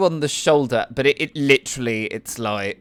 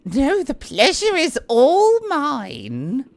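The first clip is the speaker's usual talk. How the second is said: much higher, slow and flirtatious noble lady; Annette's voice; 11 semitones higher, slower than usual. Acted. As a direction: lay on the charm, slowly. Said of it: "she hands her hand over".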